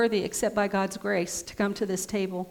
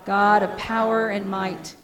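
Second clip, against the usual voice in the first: louder solemn liturgy reading; liturgical proclamation; 4 dB louder than usual. Acted. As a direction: proclaim the prayer to the hall. Great thanksgiving recited.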